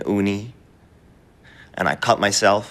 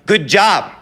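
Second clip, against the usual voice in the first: high, loud burst of imagined praise; 9 semitones higher, 5 dB louder than usual. Acted. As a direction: cry it out like long-wished-for applause. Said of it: "wanted him to be like".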